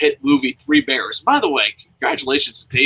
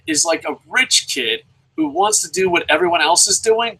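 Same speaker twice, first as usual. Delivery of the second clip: ordinary talk, nothing marked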